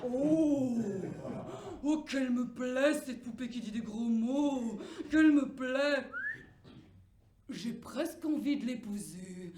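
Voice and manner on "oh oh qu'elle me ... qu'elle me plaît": smitten dwarf. Acted.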